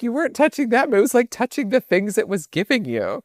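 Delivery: high exasperated voice. Retold or imitated. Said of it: "I was like".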